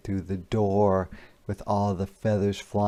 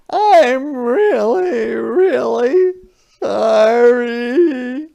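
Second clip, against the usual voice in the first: high, slow wailing apology; crying sorry; 13 semitones higher, 9 dB louder, much slower than usual. A narrator's reading. Harriet crying to her mother, high loud wail. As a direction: wail it like a sobbing child. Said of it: "Harriet cried".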